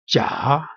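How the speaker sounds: "jia" dipping low, then climbing; falling-rising tone. Shown as a demonstration. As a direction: take "jia" down and back up. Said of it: "going down and then upward".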